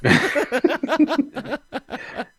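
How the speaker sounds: high, helpless burst of laughter; laughing. Real, not acted. Storyteller amused by his own tale, real high laugh. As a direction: crack up at your own joke.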